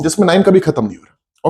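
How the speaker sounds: ordinary delivery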